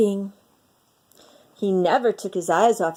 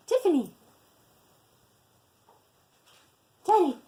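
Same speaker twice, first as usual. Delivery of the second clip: fond introduction; 9 semitones higher, much faster than usual. Real, not acted. Introducing her two little dogs.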